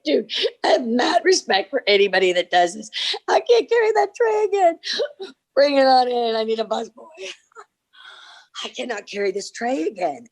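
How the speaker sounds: high, laughing mock complaint; comic re-enacted plea to give up; exasperated pleading. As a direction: whine it as if worn out. Retold exhaustion carrying the tray.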